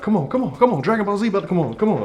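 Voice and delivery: high, hurried begging; urgent pleading. Acted. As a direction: play a kid rushing mom out the door. Rushing mom out with an excuse.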